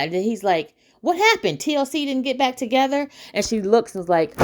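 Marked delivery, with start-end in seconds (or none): 1.0-3.1 high-pitched imitation of a nosy fan; eager fan question. Fan asking a star about the group's reunion, high eager questioning. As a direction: ask it high, in playful disbelief.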